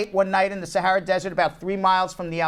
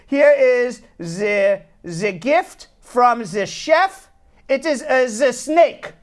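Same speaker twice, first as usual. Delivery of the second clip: put-on very slow, halting foreign waiter accent; broken English; 4 semitones higher, 4 dB louder, much slower than usual. Waiter presenting the chef's gift to diners, in slow broken English. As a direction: imitate a waiter's broken English, higher and drawn out. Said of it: "in his broken English".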